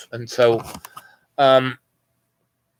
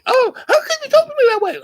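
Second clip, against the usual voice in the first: offended lady; faster than usual. Retold or imitated. Homeowner shocked by insult.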